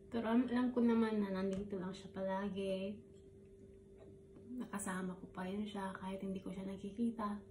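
usual speaking voice

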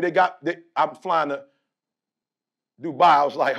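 ordinary talk, nothing marked

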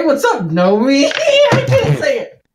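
high, loud laughter breaking into the words; can't stop laughing. Real, not acted. Player attempting a joke greeting to the gnome, helpless laughter. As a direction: crack up at your own joke. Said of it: "couldn't say it without laughing".

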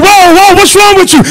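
high, loud alarmed cry; a husband shocked by his screaming wife; startled husband. Retold or imitated.

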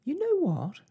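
put-on high, loud young girl bursting out; sudden exclamation. A narrator's reading. Daughter has an idea for mother, sudden exclamation. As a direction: blurt it eagerly, pitch leaping. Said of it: "exclaimed Arrietty suddenly".

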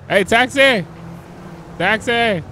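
shouting for a cab across the street; hailing a taxi. Real, not acted. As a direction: call out loud to stop the taxi. Calling out to a passing cab.

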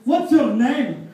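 loud, high Scottish "Oi", slowed name question; brash Scottish chat-up; Scottish accent. Acted. Scottish guy hitting on a girl, loud Scottish accent. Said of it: "a Scottish guy".

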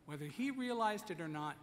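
ordinary delivery

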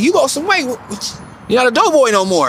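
mock coach teasing him, high and bright-eyed; coach's voice. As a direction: coach ribbing a pudgy player, in a high mock voice. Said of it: "he's like".

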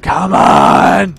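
loud, fed-up "come on"; exasperated yell. Real, not acted. Player yelling at the indecisive narrator, fed up. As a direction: call out in mock frustration. Narrator keeps changing directions.

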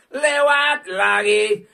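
loud, very slow stretched yell; drawn-out shout. Acted. Streamer bellowing at the machine, slow and loud. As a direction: bellow it out slowly. Chant repeated louder.

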